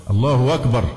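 "الله أكبر" run together as Allahu wakbar; inserted waw. Shown as a demonstration. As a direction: turn the hamza of "أكبر" into w. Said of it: "كأن في واو".

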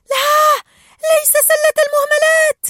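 very high, loud group yell; panicked shout. A narrator's reading. Tank fish see the wastebasket, high loud shout of protest. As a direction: cry out in protest. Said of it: "فصاح سكان الحوض".

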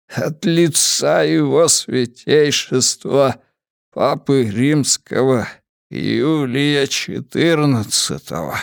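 measured, solemn papal messenger; official courier voice. Acted. Papal courier gives an order. (медленный официальный голос курьера)

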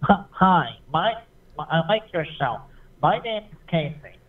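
usual speaking voice

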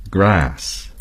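American English grass, with a flat a as in cat; American pronunciation of grass. Shown as a demonstration. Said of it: "and Americans would say".

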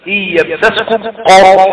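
slow recited قرح with a thick R; heavy ra. Shown as a demonstration. Teacher repeating verse example for قرح, heavy R. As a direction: recite slowly, landing on a full, heavy ر in قرح. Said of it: "القاف مفتوحة".

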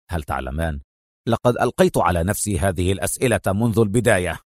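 low, quick delivery; hurried. A narrator's reading. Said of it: "وهو يقول في سرعة". (بسرعة)

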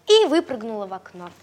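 usual speaking voice

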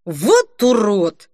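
loud, stumbling over words; slurred drunken outburst; drunk slurring. A narrator's reading. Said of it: "Он спотыкался на каждом слове".

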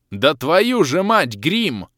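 loud, slow, high furious curse; furious yell. Acted. Hero raging at the wolf, furious yell. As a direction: curse at the monster in rage. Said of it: "в бешенстве заорал я".

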